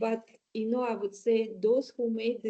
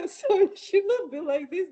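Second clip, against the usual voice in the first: louder, high laughing outburst; 9 semitones higher, 6 dB louder than usual. Real, not acted. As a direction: burst out laughing at the irony. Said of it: "laughing at cartoon's irony".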